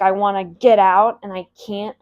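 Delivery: loud, pleading cry to get out; trapped desperation. Pretend. Speaker voicing a caged listener, loud desperate plea. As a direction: shout it like a rat stuck in a cage.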